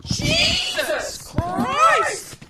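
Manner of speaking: high, slow drawn-out despairing shout; exasperated shout. Real, not acted. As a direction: wail it in disbelief. More packaging, exasperated.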